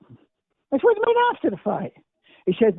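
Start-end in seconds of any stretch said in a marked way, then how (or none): 0.8-1.9 surprised. Retold or imitated. Retold disbelief at Ali's answer.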